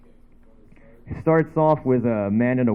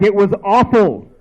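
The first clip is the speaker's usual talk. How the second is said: louder, higher exclaiming; mock outraged verdict; 6 semitones higher, 5 dB louder than usual. Critiquing friend's acting, exaggerated loud protest. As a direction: blurt out the harsh review, pitched up and loud.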